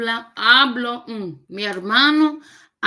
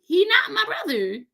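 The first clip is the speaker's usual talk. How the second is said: high, playful dropped-verb "He not my brother"; 9 semitones higher than usual. Shown as a demonstration. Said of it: "if you wanna be technical".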